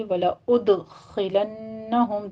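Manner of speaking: slow وَلَأُدْخِلَنَّهُمْ with an echoing dal and a held nasal nun; tajweed demo. Shown as a demonstration. Teacher demonstrating qalqala and ghunna, slow. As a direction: bounce the dal and hum the doubled nun. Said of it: "دال کے اوپر قلقلہ کریں گے".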